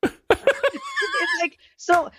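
high, slow, breaking with laughter; laughing to tears. Real, not acted. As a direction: barely get it out through laughing. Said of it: "I've got tears".

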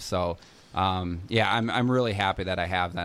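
ordinary talk

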